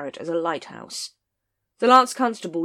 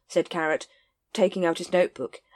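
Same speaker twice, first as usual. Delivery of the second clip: plain reading voice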